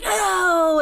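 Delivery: high, drawn-out wail of refusal; mock child protest. Child protesting a brother stole the toy, high and wailing. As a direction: imitate a kid whose toy was grabbed. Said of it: "like, arguing".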